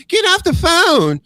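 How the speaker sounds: high, loud mocking impression of a shrill demand; mock Karen voice. Mocking the cab driver as a Karen. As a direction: ham up a bossy driver barking orders. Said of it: "This is like".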